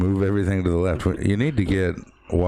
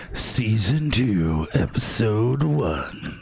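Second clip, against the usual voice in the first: announcer voice; 3 semitones higher, slower than usual. Pretend. Host showing off a new intro, announcer voice. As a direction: stretch it out like a show intro. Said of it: "I just like saying stuff in my ... announcer voice".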